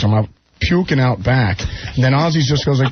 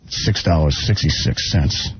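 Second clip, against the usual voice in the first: sinister price; 7 semitones lower, slower than usual. Retold or imitated. Shopkeeper quoting a sinister price.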